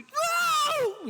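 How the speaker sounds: high, loud passing scream; imitated roller coaster scream. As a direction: mimic riders shrieking as they whip past. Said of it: "people screaming, like, bloody murder".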